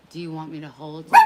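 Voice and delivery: loud, quick question to the dog; loud fast question. Real, not acted. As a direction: blurt it out over the noise. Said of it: "trying to talk in a nice, somber voice".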